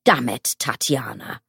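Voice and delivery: low, contained anger; angry. A narrator's reading. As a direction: swear in low exasperation. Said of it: "He was flushed with anger".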